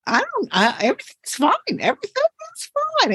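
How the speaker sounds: acted nervous denial, climbing very high; voice going up. Acting out someone unready to let go, voice going up. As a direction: let the pitch shoot up into a mock squeak. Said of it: "And your voice goes up".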